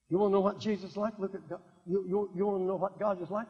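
ordinary delivery

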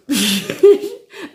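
short high, loud laugh. Real, not acted. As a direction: burst out in a quick high giggle. Laughs at her own purchase.

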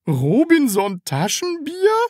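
high, slow, baffled question; puzzled, drawn-out query. A narrator's reading. Landlady repeats the odd name, baffled and high. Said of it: "fragte Frau Rotkohl verblüfft".

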